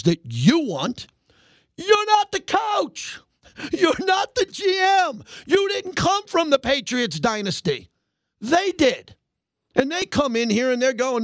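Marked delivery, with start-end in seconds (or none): 1.8-7.9 high, slow, strained shout. Real, not acted. Host yelling at Raider fans who second-guess the front office, strained shout. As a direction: bellow it at the fans, slowly.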